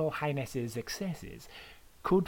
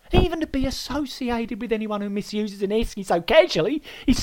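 loud, climbing higher with each mate; outraged Aussie rant; 5 semitones higher, 7 dB louder than usual. Acted. Host Aaron lectures the disgraced guest, loud and rising.

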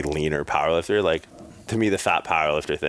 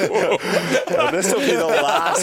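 laughing; 14 semitones higher, 3 dB louder, much slower than usual. Real, not acted. Joking, laughing at friend eating.

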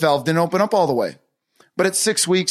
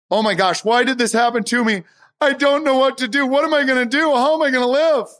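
higher panicked imitation of someone overwhelmed; mock panic; 8 semitones higher than usual. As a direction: act out a frantic "why me" spiral. Acting out a panicked victim mindset.

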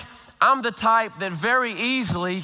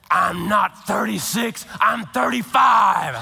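slow, loud mock indignation; wife correcting her age with fierce passion; indignant shout; 4 dB louder, slower than usual. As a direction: correct him fiercely, drawn out. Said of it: "with the same passion".

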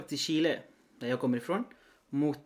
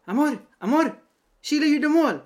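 much higher and louder, thrilled cheering; excited girlfriend; 12 semitones higher, 8 dB louder than usual. Retold or imitated. Girlfriend calling out Chile scored while he is throwing up, high excited cry. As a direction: imitate the girlfriend bursting out with the goal news. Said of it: "så hör jag henne säga".

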